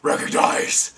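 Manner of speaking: low, rough hero growl; London Batman voice. Pretend. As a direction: growl it out like a masked vigilante. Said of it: "the old London Batman's funnier".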